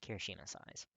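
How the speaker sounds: usual speaking voice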